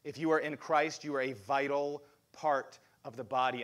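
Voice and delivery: ordinary delivery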